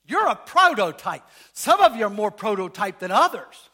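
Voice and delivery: loud, emphatic preaching; proclaiming loudly. Real, not acted. Preacher to congregation, a loud proclamation. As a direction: proclaim it to the whole church.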